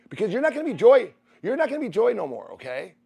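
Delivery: high, loud, insistent push; emphatic retold urging. Husband retelling how he urged his wife, loud and high. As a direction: drive it home to her, forcefully.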